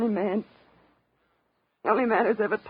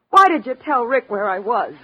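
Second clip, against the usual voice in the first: accusing; 3 semitones higher, 10 dB louder than usual. Acted. Selma accuses Stone.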